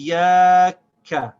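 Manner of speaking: IYAKA high and loud, long held iyya; emphasized shad. Shown as a demonstration. Teacher repeating three syllables, loud and drawn out. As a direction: hold the y of IYAKA, then short ka. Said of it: "can't stop the sound of the ya".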